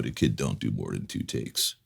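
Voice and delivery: lightly voiced protective father figure; paternal warning. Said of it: "in typical paternal form".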